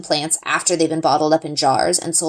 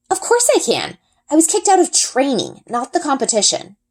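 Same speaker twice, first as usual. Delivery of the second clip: higher, sharp and indignant; mild indignant protest; indignant retort; 8 semitones higher than usual. A narrator's reading. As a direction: push back hard on his claim.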